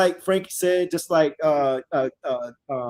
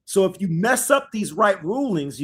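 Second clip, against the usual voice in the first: usual speaking voice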